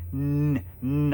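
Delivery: "N-" said slowly and precisely, a clear "nin" sound; careful first syllable. Shown as a demonstration. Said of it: "listen to when I say it".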